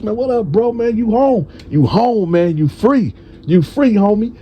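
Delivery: high-pitched, celebratory greeting; excited welcome home. Retold or imitated. Retold joyful welcome home.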